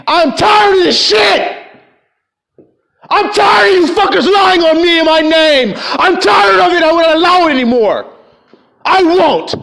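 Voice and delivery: preacher shouting his fury at the crowd; furious shout. Real, not acted. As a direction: cry out that you're fed up. Preacher erupts in anger.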